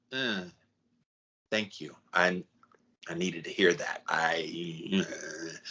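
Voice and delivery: soft, low, halting gratitude; hushed, hesitant acceptance, acted; humbled thanks. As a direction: take it in quietly, with pauses. Acted grateful reaction to tough love.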